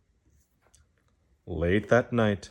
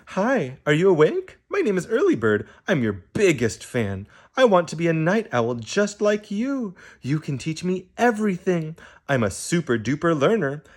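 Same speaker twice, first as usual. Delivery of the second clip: high, bubbly little-bird voice, full of questions; eager little bird; 6 semitones higher than usual. Acted. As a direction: gush like an adoring young fan. Early Bird chatters at sleepy Owl.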